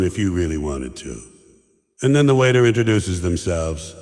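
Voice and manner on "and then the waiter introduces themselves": ordinary delivery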